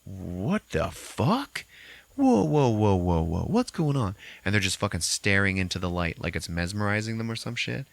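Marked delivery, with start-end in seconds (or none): 0.1-4.2 slow amazed gasp, then startled whoas; stunned. Retold or imitated. Characters seeing lights through the cracks, stunned exclaims. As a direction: react as if blinded by light. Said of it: "And you're like".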